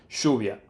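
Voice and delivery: lluvia with the double L said as sh, shoo-vee-ah; Spanish double L. Shown as a demonstration. Teaching her own Spanish word for rain, lluvia said with sh. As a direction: say lluvia the way she says it, sh at the start. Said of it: "if I say rain in Spanish".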